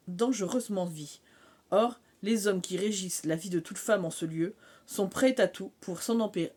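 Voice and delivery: a lightly heightened back-cover read; blurb reading. Acted. Vlogger reading the blurb to viewers, teaser read. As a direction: read the book summary out like a teaser.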